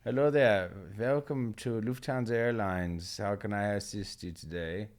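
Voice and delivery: loud mock-German airline greeting; terrible German accent. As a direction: greet callers in a badly faked German accent. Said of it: "that was a terrible German accent".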